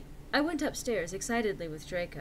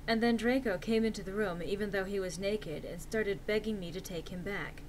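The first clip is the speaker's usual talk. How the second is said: ordinary delivery, nothing marked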